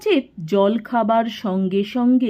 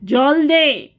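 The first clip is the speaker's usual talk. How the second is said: sudden loud, higher-pitched command; sharp scolding; 5 semitones higher, 5 dB louder, much slower than usual. A narrator's reading. Shachinath demands water from Fatik, scolding sharply. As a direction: scold him into obeying at once. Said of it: "হঠাৎ প্রায় ধমকে উঠলেন".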